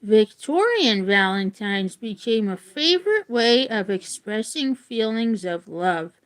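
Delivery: clear, measured book-reading voice; reading aloud. Acted. Reading a Valentine's history book to viewers, in an even read-aloud voice. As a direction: read it out like a class textbook.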